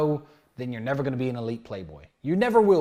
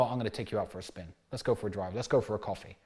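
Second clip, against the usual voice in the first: softer, quick easy offer; soft casual invite; 6 semitones lower, 9 dB quieter, faster than usual. Retold or imitated. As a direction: toss it off softly and quick. Suggesting outing to woman.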